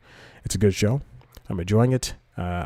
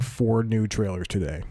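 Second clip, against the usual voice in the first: ordinary talk, nothing marked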